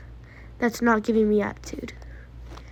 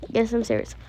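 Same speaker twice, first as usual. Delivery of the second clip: ordinary talk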